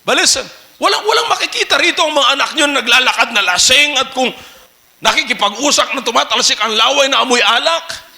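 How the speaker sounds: usual speaking voice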